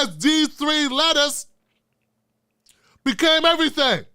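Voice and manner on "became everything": ordinary talk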